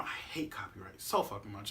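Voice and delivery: fast whispered grumble; whispered aside. Real, not acted. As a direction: grumble it under your breath. Aside about copyright.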